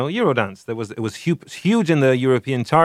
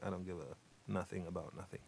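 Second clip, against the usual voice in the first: flat muttered deadpan, very quiet; mumbled deadpan nineties lyric; 5 semitones lower, 24 dB quieter, faster than usual. Retold or imitated. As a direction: throw it away, barely voiced. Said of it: "a very deadpan kind of".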